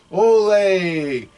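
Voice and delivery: high loud shouted Ole; mocking stadium cheer; crowd cheer.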